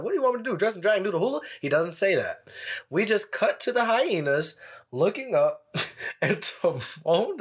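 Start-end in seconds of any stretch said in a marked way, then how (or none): 0.0-1.4 quoted cartoon line. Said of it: "Timon does not say that line of".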